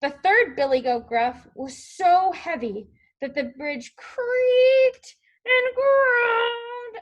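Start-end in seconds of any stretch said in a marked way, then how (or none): 3.9-7.0 high, heavily stretched-out words; creaking, groaning. A narrator's reading. Narrator describes bridge under the heavy goat, creaking and groaning. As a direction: drag the words out like straining timber.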